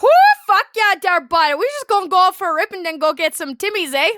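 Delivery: put-on loud, high Albertan rig-pig accent; thick Canadian accent. Showing a friend how oil-rig workers talk, thick Canadian accent. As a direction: whoop it up in a broad Canadian accent. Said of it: "if it's a thick Canadian accent".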